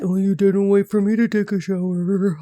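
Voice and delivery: mock sulky whine of a clingy partner; put-on hurt, pouty voice; upset, pouting boyfriend. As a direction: imitate a pouting man feeling left out. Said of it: "he would just get so upset".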